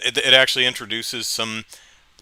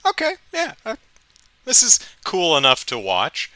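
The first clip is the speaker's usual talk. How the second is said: impressed inner voice; 9 semitones higher, 6 dB louder than usual. Retold or imitated. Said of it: "episode two was like".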